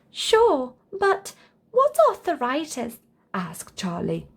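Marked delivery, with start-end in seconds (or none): 0.1-3.0 put-on high, puzzled little boy; high childlike question; curious child. A narrator's reading. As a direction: ask like a curious kid. Charlie voiced.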